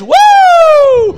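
high whooping shout; excited church whoop; shouting woo. Retold or imitated. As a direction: whoop like a man running bases in church. Man running bases in church.

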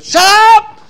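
very loud, high-pitched demonic scream. Retold or imitated. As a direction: scream it full out as the demon interrupting the call. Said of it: "this voice screamed".

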